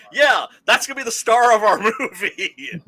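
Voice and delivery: loud, high sarcastic cheer breaking into laughter; mock enthusiasm. Co-host mocks the studio for casting a mumbler, loudly sarcastic. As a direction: hype it up mockingly, laughing through the end. Mocking whoever cast the mumbler.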